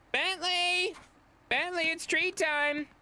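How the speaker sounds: high, sing-song dog-calling voice; cheerful treat-time call to the dog. Real, not acted. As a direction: call her over, high and slow.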